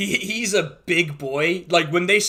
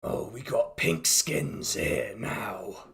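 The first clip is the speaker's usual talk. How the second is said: low, slow contemptuous growl; scornful war chief; 8 semitones lower, 6 dB quieter, slower than usual. Acted. Orc war chief sizing up the party, low slow scorn. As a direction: sneer it down at the outsiders, slowly. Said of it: "It's like".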